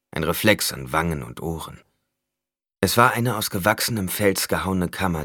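ordinary delivery, nothing marked

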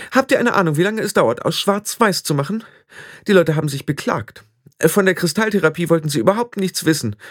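put-on high, breathless girl pouring out troubles; a proud girl near tears ranting; overwhelmed apprentice witch venting, high and anxious; frantic young witch. Anagramma pours out her woes.

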